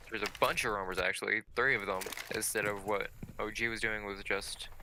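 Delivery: normal talking voice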